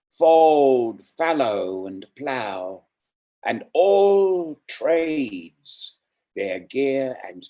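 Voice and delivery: slow, sonorous poetry reading; poem recital. Acted. Host reciting Hopkins to the audience, rolling and reverent. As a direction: read each image like a prayer.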